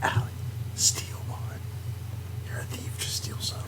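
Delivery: very soft, secretive urging; conspiratorial whisper. Pretend. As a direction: whisper it to a fellow thief. Secretly urging player to steal.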